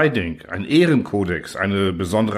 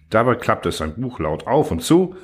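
ordinary delivery

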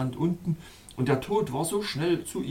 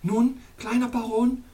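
much higher princess voice calling; Snowflower calling; 10 semitones higher, 4 dB louder than usual. Acted. Princess calls to the baron for his answer, high bright call. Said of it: "rief Schneeblume".

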